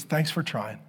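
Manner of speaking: wife hugs husband, softly thanking him; soft sleepy thanks. Retold or imitated. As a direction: hush it like a just-woken wife.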